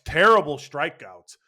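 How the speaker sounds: ordinary delivery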